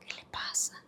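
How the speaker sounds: hushed, voiceless aside; whispered question. Retold or imitated. Asking a mother during mass what is wrong, whispering. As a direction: whisper it discreetly, almost mouthing it. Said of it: "casi con señas volteé y le pregunté".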